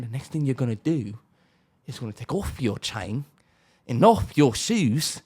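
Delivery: low, quiet British gangster; mock British accent. British gangster demanding chain and shoes, low mock British accent. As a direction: drop into a lightly voiced British menace. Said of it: "talking in a British accent".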